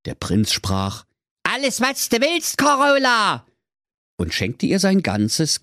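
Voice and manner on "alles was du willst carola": hohe verstellte Märchenprinz-Stimme; Prinzenstimme. Acted. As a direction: spiel den verliebten Prinzen mit hoher Stimme. Prinz spricht in Figurenstimme. (hohe, verstellte Prinzenstimme)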